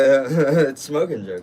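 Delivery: slow, drawn out through a laugh. Real, not acted. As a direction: savour the pun while laughing. Laughing at his own pun.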